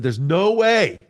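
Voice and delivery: loud, high-pitched retold protest; emphatic refusal. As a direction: exclaim it in disbelief, stretching out the final word. Acting out his refusal to volunteer.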